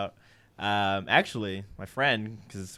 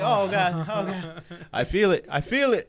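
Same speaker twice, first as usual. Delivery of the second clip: mock panic; 6 semitones higher than usual. Gates closed, urgent need.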